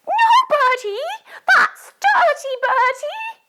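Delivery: very high, loud scolding granny; grandma voice. Acted. Grandma telling Bertie off for licking the dog, scolding. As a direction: tell him off as a squawking grandma. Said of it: "Can you do it in a grandma voice".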